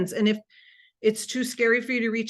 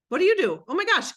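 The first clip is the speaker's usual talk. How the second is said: higher and louder, bright curious question; excited demo question; 7 semitones higher, 4 dB louder, faster than usual. Shown as a demonstration. Coach showing an excited opener to a prospect. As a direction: sound thrilled to hear their answer. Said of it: "That's all you gotta do".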